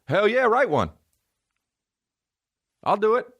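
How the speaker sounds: high eager mock agreement; imitated eagerness. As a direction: jump at it gleefully.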